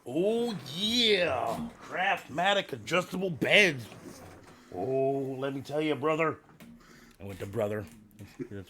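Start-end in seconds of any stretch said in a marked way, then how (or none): 0.1-6.4 raspy wrestling promo growl; Macho Man Randy Savage. Acted. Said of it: "cut a promo for is Macho Man Randy Savage".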